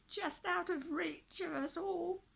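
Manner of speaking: put-on high, loud, creaky old villager voice; croaky old man. A narrator's reading. Old villager pleads with the knight to free them, croaky. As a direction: croak it out like a frail old prisoner. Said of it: "in a very croaky voice".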